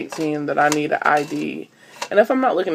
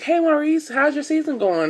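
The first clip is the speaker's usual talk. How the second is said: bright lilting hello pitched way up; high sweet greeting; 9 semitones higher than usual. Retold or imitated. Vlogger greeting her twelve-year-old cousin, high and sweet. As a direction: greet the kid in a sugary high voice. Making small talk with cousin.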